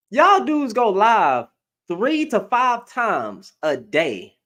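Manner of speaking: each word dragged out, slow and pointed; slow scolding. Real, not acted. Scolding dudes who go live all day, slow and pointed. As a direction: spell it out slowly in disbelief. Scolding online men, drawn out.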